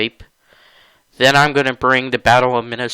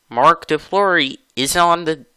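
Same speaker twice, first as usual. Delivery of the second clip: usual speaking voice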